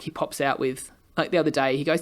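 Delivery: ordinary talk, nothing marked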